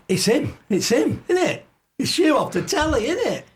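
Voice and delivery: high, eager fan voice pushing up; imitating his tipsy self badgering a celebrity, high and eager; tipsy starstruck fan. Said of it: "and I'm going".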